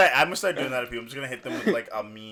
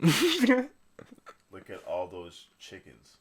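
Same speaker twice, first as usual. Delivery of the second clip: very slow, lower meme line; slow drawn-out meme; 7 semitones lower, much slower than usual. Retold or imitated. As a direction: stretch every word out slowly.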